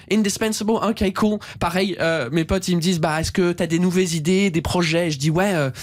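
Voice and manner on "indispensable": « Indispensable » en anglais, plus aigu, in-dis-PEN-sa-bel; indispensable à l'anglaise. Shown as a demonstration. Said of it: "le mot indispensable". (« Indispensable » prononcé comme en anglais)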